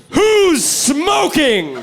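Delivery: slow, high, loud mock police bark; cop shouting. Cop at a school play shouting out the stoner, slow and loud. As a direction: bellow it like a cop busting the room. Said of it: "and goes".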